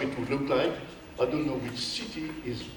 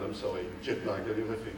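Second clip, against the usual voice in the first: tired apology; 6 semitones lower, 8 dB quieter, faster than usual. Real, not acted. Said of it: "I'm a little tired".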